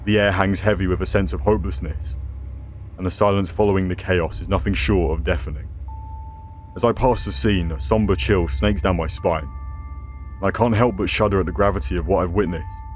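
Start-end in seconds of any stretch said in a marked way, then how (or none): none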